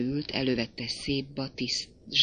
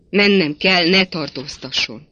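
loud, raised urgent insistence; insistent; 3 semitones higher, 8 dB louder than usual. A narrator's reading. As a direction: break away firmly and loudly. She repeats it, breaking away. (hangos, sürgető határozottság)

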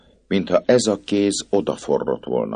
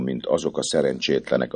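ordinary delivery, nothing marked

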